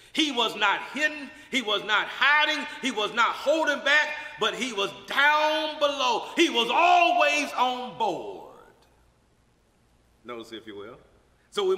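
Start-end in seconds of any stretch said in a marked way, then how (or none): 0.1-8.5 loud, rising preacher's cry, slow at the end; full-voiced pulpit proclamation; preaching at full force. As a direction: build the sermon to a shout. Preaching climax.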